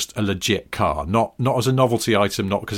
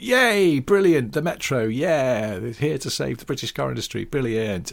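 mock cheering; 5 semitones higher than usual. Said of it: "British press got behind it and went".

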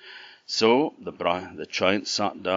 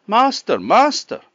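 much higher, loud cry of alarm; harp shouting; 9 dB louder than usual. A narrator's reading. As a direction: call out high and loud to wake the master. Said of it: "the harp shouted out".

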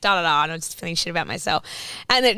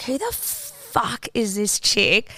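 slow incredulous mutter; 3 semitones higher, 3 dB quieter, much slower than usual. Retold or imitated. Incredulous aside about stranger.

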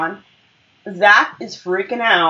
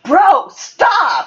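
high, exasperated mock shout; retold exasperated yell; 8 semitones higher than usual. Aaron telling Zach to stop, exasperated. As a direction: cut him off, imitating a fed-up friend.

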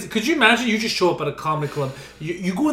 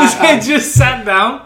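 usual speaking voice

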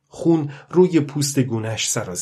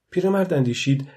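ordinary delivery, nothing marked

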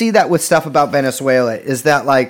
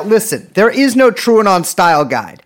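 ordinary talk, nothing marked

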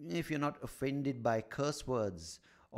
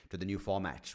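normal talking voice

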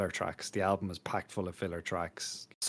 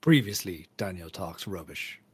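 TV recap voice. Pretend. Mock podcast recap.

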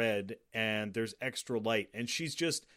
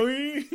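a high, loud cartoon ding; ding sound effect; 14 semitones higher, 6 dB louder than usual. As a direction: pop out a bright ding like an eye springing open. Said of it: "his eyes just go dink".

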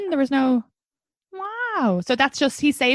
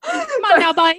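mock cheer; 7 semitones higher, 3 dB louder than usual.

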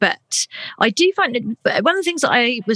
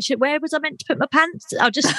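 flustered panic; 7 semitones higher than usual. Retold or imitated. Panicking client voiced.

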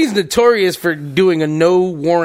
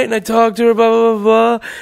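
ordinary talk

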